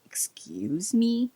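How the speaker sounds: slow, breathless, indignant whisper; proud sorceress taken aback by a sarcastic witcher, whispering; haughty whispered excuse me. Retold or imitated. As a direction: hiss it like an offended sorceress. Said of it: "and she's like".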